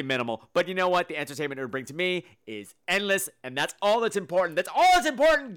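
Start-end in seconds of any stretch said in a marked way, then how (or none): 4.6-5.5 much higher and louder, stressing all; loud emphatic repeat. Shown as a demonstration. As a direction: shout the line as a big declaration. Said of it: "repeated with emphasis".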